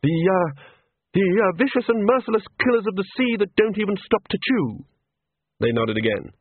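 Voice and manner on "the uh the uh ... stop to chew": high-pitched, emphatic character voice; high voice, hesitant start then pressing on; character dialogue. Acted. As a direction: describe the killers in a high, insistent voice.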